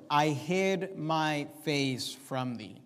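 "I hid my face from thee" read very slowly, each word set apart; slow the verse right down to make them notice; weighty scripture reading. Shown as a demonstration. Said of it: "notice the words".

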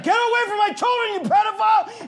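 high, loud shout; a father re-enacting a shout at a stranger, high and loud; retold shout. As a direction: shout him away from the kids. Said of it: "like, yelling".

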